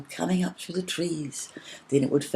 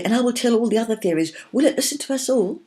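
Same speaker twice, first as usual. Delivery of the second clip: fast, higher, louder and eager; the mud fairy thrilled by the promise; excited; 8 semitones higher, 5 dB louder, faster than usual. A narrator's reading. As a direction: gush it out in delight. Fairy eagerly answers cloud.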